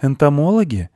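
put-on high boy's question; high-pitched young boy asking gently; little boy. A narrator's reading. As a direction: ask in a high child voice. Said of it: "предположил мальчик".

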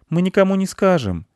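put-on high-pitched boy voice; little boy promising a secret; conspiring boy. A narrator's reading. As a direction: offer it up high like a child. Boy reassures the shamed wolf.

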